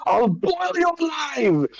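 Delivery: high, drawn-out enraged threat; screaming at water. Acted. Fire caster threatens the ocean, enraged high scream. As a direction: scream it high, stretching the words. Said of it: "I'm just screaming out the water".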